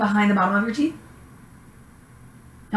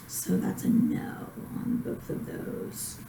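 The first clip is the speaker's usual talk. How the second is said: whispering; 11 dB quieter, slower than usual. Real, not acted. Aside noting exam result.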